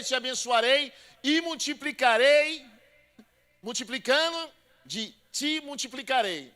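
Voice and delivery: loud, high, drawn-out preaching; full-force preaching. Acted. Pastor preaching Hebrews to the church, full force. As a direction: proclaim God's oath to the congregation.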